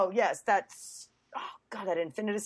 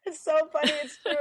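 usual speaking voice

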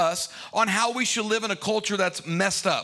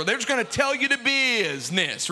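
usual speaking voice

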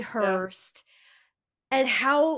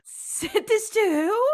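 incredulous; 10 semitones higher, slower than usual. Real, not acted. Disbelief at the pickup line.

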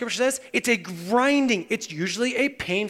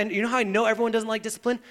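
normal talking voice

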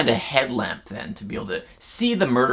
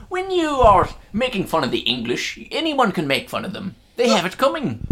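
mock Irish-tinged accent; 8 semitones higher than usual. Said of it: "You sound a bit Irish there".

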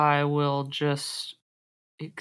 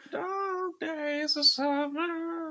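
high drawn-out echo; 12 semitones higher, 5 dB quieter, much slower than usual. Acted. Echoing the GM dreamily.

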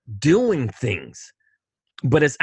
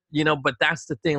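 usual speaking voice

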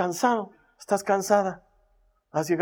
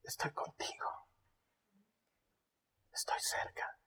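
soft unvoiced whisper; God whispering; 17 dB quieter than usual. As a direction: murmur comfort close by. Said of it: "Es un susurro suave".

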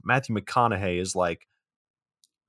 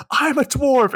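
much higher, louder mock shout; mock yell; 6 dB louder, slower than usual. Friend recalling the scene, mock yell. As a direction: blurt it like a movie outburst. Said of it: "we just hear him say".

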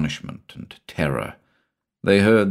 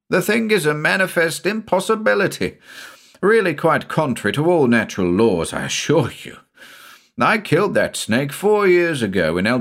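high-pitched, emphatic insistence; incredulous protest; 8 semitones higher than usual. A narrator's reading. Avilton assuring Shula the snake is stuffed, incredulous protest. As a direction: argue that it simply cannot be. Avilton disputes the frightened man's story.

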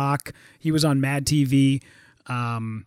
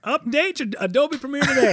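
higher-pitched sales-pitch parody; hammed-up update reminder; mock update nag; 7 semitones higher than usual. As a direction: nag like a pop-up. Mocking the software's update prompt.

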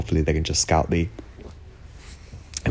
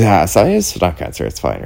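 higher, louder brush-off that drops away; dismissive; 3 semitones higher, 7 dB louder than usual. Retold or imitated. Dad brushing off a cancer worry, dismissive. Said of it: "it's not nice to be dismissed".

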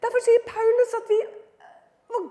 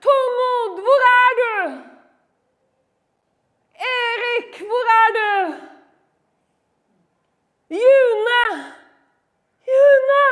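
loud, higher searching calls; calling out; 4 semitones higher, 7 dB louder, much slower than usual. Acted. Holy Spirit searching for straying believers, calling out. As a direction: call names across a distance, pausing between. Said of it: "Sånn holder Den hellige ånd på".

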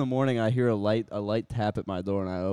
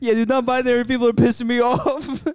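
high laughing mock rant; 11 semitones higher, 6 dB louder than usual.

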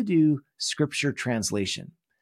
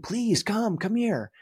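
imagined Lehi calling; 10 semitones higher, slower than usual. Pretend. Lehi beckoning family, imagined.

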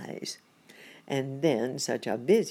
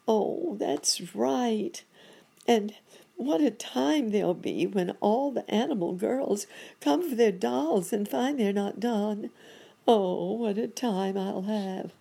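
high, wailing lament; higher-pitched, dismayed character voice; fretting monkey doctor; 7 semitones higher than usual. Acted.

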